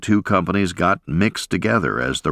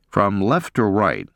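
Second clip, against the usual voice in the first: ordinary delivery, nothing marked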